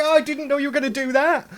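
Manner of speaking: high, loud mock astonishment; surprised. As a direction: act startled by your own character. Author surprised by own character.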